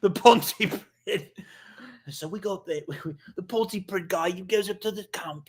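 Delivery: high-pitched comic Welshman; Welsh accent. Acted. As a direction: tell it as an indignant, sing-song Welsh fella. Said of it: "What about the Welsh?".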